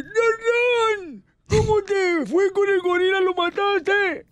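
high, strained voiceless cry; hoarse tiger. Acted. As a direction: call out like a tiger who lost his voice. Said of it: "Y dice". (tigre afónico, voz ronca y aguda)

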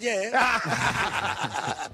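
high, loud laughing yeah; a big laughing yes. Retold or imitated. Beefy's reply, laughing.

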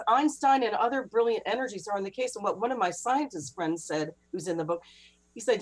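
ordinary talk

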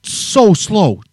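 stretched very slowly; slow, exasperated drawl; drawn out. Real, not acted. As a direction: drag the words out like a snail. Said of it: "this man moved at a snail's pace".

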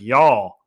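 y'all stretched long and loud; drawn-out y'all. Retold or imitated. Arkansas coworkers drawling y'all with pride. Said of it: "draw it out".

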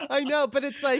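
talking through a laugh; laughing reply to a joke. Real, not acted. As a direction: laugh the words out. Laugh heard on the line.

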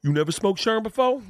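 high, put-on raspy celebrity voice, amused; a hammed-up Tracy Morgan impression; imitating Tracy Morgan. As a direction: play Tracy surprised the newbie never had Sherm. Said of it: "And he's like".

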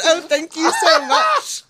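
slow, laughing fake British thanks; laughing British accent. Shown as a demonstration. Teen thanking the shopkeeper, laughing fake British accent. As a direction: thank the clerk in a hammed-up British voice. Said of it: "my British accent".

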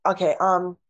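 ordinary delivery

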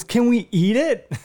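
put-on eager toddler. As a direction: ask it like a hungry little kid. Toddler asks to eat the deer.

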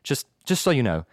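low, measured British doctor; London accent. Acted. Doctor calling a school about a patient, low London accent. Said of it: "So that was a London accent".

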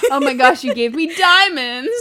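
loud, excited fake delight; mock gushing. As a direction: gush like you got diamonds. Mock gushing over diamonds.

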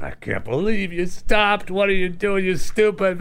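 high, strained mock rage; mad ranting. Driver fumes alone after rear-ending a car, mock ranting. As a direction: re-enact a furious outburst behind the wheel. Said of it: "I'm just slamming my steering wheel".

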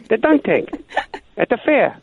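high mock caller explaining; the weird caller spelling it out patiently; prank caller voice. Caller explains the dunk tank to the sportscaster, high mock voice. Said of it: "he, he says".

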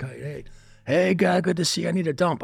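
a man flying on coke, too happy; coked-up friend. Retold or imitated. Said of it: "He's fucking high".

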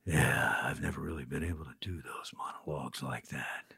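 hushed breathy Clint impression; quoting Clint. Actor imitating Clint's hushed praise. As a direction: whisper it like Clint paying a compliment. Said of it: "he goes".

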